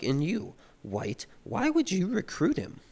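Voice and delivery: high-pitched, drawn-out retold challenge; lightly hammed-up indignant question; mock accusation. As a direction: act out an arguing dean demanding an answer.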